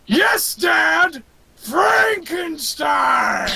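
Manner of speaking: high, drawn-out defiant bellow; slow, triumphant creature roar; monster shout. Acted. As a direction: shout it at the ceiling like Frankenstein's monster. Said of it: "looks at the ceiling and he shouts".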